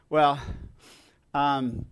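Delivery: usual speaking voice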